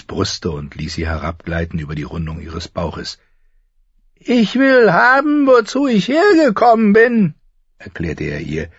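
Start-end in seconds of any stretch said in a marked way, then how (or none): 4.1-7.3 crazed demand. A narrator's reading. Brother at sword-point to his sister, loud high raving demand. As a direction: demand it like an unhinged, entitled prince.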